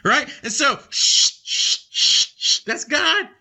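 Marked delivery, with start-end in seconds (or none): 1.4-2.3 hushing shh. Real, not acted. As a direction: hush the room before the point. Hushing the congregation.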